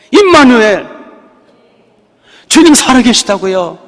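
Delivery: high, loud declaration; shouted proclamation. Real, not acted. Preacher proclaiming to the congregation that the Lord lives, loud and high. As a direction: announce it to the whole church.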